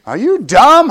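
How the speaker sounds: mock the teacher's scorn; a pastor imitating a teacher's taunt. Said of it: "a teacher told me I was dumb".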